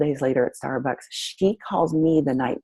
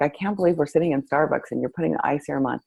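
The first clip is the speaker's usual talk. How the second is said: ordinary talk, nothing marked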